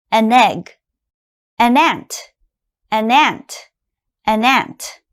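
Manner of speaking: "An ant" run together, n joined to the vowel; linked as one word. Shown as a demonstration. Teacher drilling linked articles, smooth. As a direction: say "An egg" and "An ant" as single words. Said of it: "it sounds like one word".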